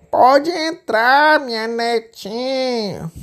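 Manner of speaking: put-on wolf luring the girl in as granny; wolf faking grandma. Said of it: "imitando a voz da vovozinha". (lobo fingindo ser a vovó, bem lento)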